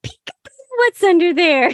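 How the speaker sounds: put-on high, singsong peekaboo to a baby; happy voice. Shown as a demonstration. Clinician lifting a baby's tongue, in a really happy voice. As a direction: go way up high and playful, as to an infant. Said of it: "really happy voice".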